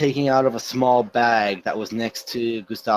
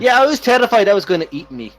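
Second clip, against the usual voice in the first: higher, louder dwarf voice; relieved dwarf; 7 semitones higher, 6 dB louder, faster than usual. Acted. Dwarf admitting he was terrified of the monster, high loud relief. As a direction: blurt it out as a shaken dwarf. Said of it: "a dwarf pokes his head out".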